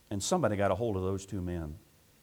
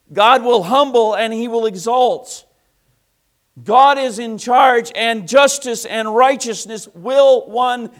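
preaching at full force; 11 semitones higher, 14 dB louder than usual. Acted. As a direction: proclaim God's justice. Preacher climax.

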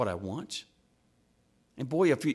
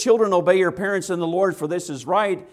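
preached quote; 6 semitones higher, 7 dB louder than usual. Acted. Quoting the verse with force.

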